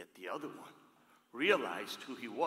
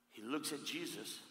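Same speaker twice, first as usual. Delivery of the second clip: ordinary talk, nothing marked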